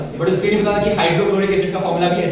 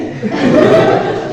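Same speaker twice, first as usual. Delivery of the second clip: high loud giggle; burst of laughter; 6 dB louder than usual. Real, not acted. Recalling greeting the chief guest, giggling laugh. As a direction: laugh out at the memory.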